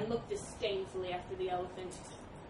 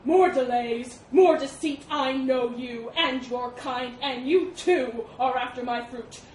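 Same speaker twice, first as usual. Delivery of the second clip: loud, furious elephant tirade; spat accusations; 3 semitones higher, 10 dB louder than usual. A narrator's reading. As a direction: hammer the boar with mounting accusations. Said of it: "spat the elephant".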